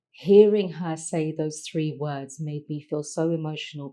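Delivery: usual speaking voice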